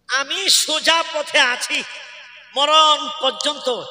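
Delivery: high opening that gradually settles; impassioned supplication. Acted. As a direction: plead phrase by phrase, drawing out the words. Voices a plea to God.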